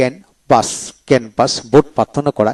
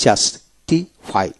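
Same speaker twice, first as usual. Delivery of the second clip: syllable-by-syllable; 3 semitones lower, 4 dB quieter, much slower than usual. Shown as a demonstration. Teacher showing how to read the verb, justify slowly split. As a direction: justify very slowly, broken into jus-ti-fy. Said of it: "আসুন কিভাবে পড়বেন".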